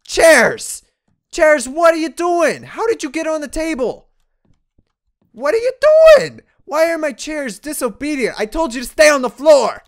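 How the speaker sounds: loud, high scolding of chairs; startled yelling. Real, not acted. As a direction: yell at the chairs in shock.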